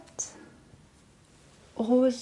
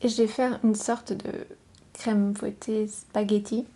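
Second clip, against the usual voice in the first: voix chuchotée douce et proche; chuchotement ASMR. Acted. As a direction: chuchoter tout près du micro. ASMR whisper with play-dough. (murmure ASMR)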